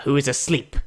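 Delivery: usual speaking voice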